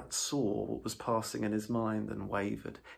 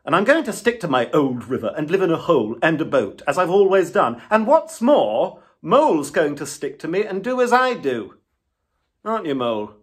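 much louder, higher, firm refusal; stubborn Rat; 7 semitones higher, 14 dB louder than usual. A narrator's reading. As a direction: dig in and refuse Toad outright.

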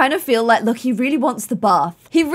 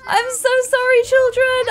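much higher, squeaky mock-wailed sorry; hammed-up apology; 13 semitones higher, 3 dB louder than usual. To the sim children after losing their presents, a much higher mock wail. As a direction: wail it like a frantic guilty parent.

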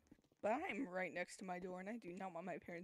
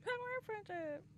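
higher, squeaky mock repeat; mocking echo; 13 semitones higher than usual. Mocking his friend's line in the game, squeaky echo. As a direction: parrot it back in a silly voice.

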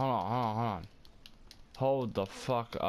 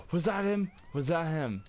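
higher, startled questioning; urgent excitement; 6 semitones higher than usual. Real, not acted. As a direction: blurt it out, voice jumping up. Spots car thief.

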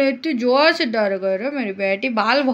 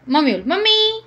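normal talking voice

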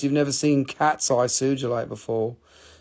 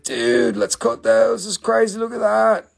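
high, loud excited retelling; 10 semitones higher, 7 dB louder than usual. Retold or imitated. Retelling how he urged his friend to cut the stones, excited. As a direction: squeal it like a thrilled kid.

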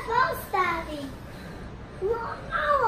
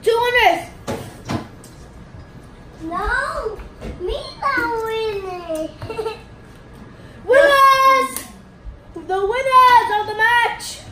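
loud victory announcement; triumphant shout; 3 semitones higher, 5 dB louder than usual. Real, not acted. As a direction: proclaim the winners loudly. Announcing the winners.

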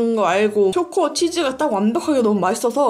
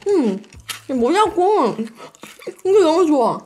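talking while chewing; mouth partly full; 4 semitones higher, slower than usual. Real, not acted. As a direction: between bites of snack. Seen 2: Bites roll at -1.0 and 3.1, lips working while talking.

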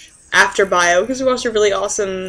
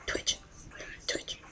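breathy whisper, much softer; whispered twitch; 14 dB quieter, slower than usual. Retold or imitated. Sleep-deprived student twitching in the lab, whispering softly. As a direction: murmur it under your breath like a nervous tic. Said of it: "the entire time I was just like".

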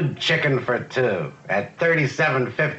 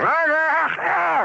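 high, loud mock rooster crow; old rooster crowing; 4 dB louder, much slower than usual. Joke teller crows as old rooster at dawn. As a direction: crow like a rooster waking the farm. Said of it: "So he goes".

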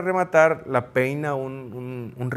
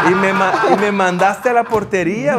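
louder, higher, laughing through the words; laughing complaint; 7 semitones higher, 8 dB louder than usual. Retold or imitated. Goalkeeper laughingly complains to coach who refused hug, laughing louder. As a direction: protest while cracking up. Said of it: "Le digo".

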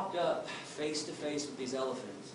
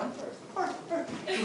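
put-on much higher, childish and giggling; playful; 12 semitones higher, 3 dB quieter, slower than usual. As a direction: tease like kids racing each other. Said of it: "playfully pushing each other".

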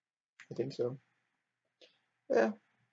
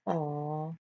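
ordinary talk, nothing marked

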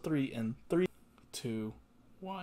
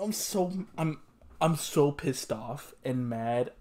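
normal talking voice

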